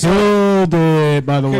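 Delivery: big, drawn-out cheer; loud hype praise. Real, not acted. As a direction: stretch it out loudly to hype him up. Hyping up the comedian's performance.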